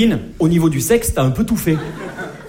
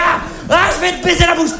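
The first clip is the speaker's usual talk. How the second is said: crude shout; 11 semitones higher than usual. Retold or imitated. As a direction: lose control and blurt it out. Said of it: "tu craques, tu fais".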